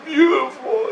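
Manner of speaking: high, drawn-out mock crying with laughter; tearful imitation. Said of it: "He's crying".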